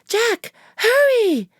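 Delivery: high, loud, drawn-out plea to hurry; urgent call. Acted. Annie urging Jack down the hallway, high eager call. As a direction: hurry him along, eager.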